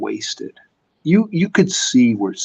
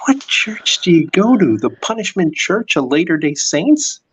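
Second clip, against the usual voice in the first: higher, louder mocking comeback; Bob's retort; 7 semitones higher, 4 dB louder than usual. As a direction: tease her with a sarcastic question. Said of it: "Bob just went and said".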